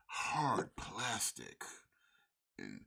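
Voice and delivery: slow, drawn-out seductive delivery; sensual voice. Pretend. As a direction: savor each word slowly. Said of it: "Oh, so sensually".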